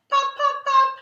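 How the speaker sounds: high, loud sing-song pop pop pop; cereal pops. A narrator's reading. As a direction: chirp it like a cute ad girl. Girl listening to cereal.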